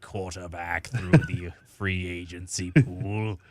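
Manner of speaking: slow, drawn-out villain decree; evil emperor pronouncement cracking into a laugh; Palpatine. Acted. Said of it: "That is very Palpatine-esque".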